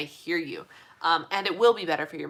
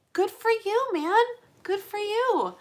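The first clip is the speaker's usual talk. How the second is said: high-pitched, heartfelt congratulations; delighted cheering; 13 semitones higher, slower than usual. Real, not acted. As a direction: cheer them on, voice way up. Cheering the viewer on.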